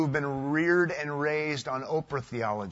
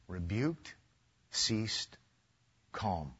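much softer, almost under the breath; hushed; 9 semitones lower, 10 dB quieter, much slower than usual. Shown as a demonstration. Preacher repeating the words to his church in a hush. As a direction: let the words fall away softly. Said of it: "repeats softly".

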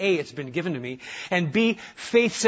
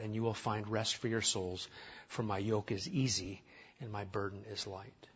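softly, tenderly, inviting rest; a lowly, gentle invitation to the weary; gentle Jesus voice; 9 semitones lower, 9 dB quieter than usual. Retold or imitated. Preacher voicing Jesus to weary Christians, soft and gentle. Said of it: "Who talks like this?".

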